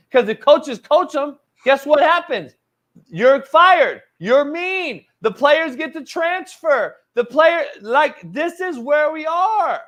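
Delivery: high, loud, worked-up rant; heated rant. Real, not acted. Host ranting to co-host about coaching today, heated.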